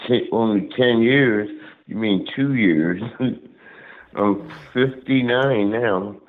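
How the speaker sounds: ordinary talk, nothing marked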